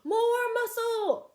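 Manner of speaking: high, slow loud call. Acted. Fred urges the pushers on, slow loud call. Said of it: "called Fred".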